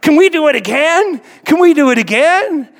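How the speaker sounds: high, loud, eager mock pleading; excited kid. Boy asking driver for another jump, excited.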